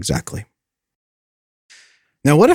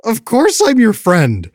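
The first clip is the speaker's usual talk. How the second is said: high, drawn-out mock reply; high-pitched reassurance; 11 semitones higher, 3 dB louder, slower than usual. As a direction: stretch it out slowly in a high imitated voice. Friend's reassurance, acted in high voice.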